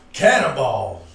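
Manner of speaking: cannonball called out loud and full. Acted. Repeats word in whisper then loud.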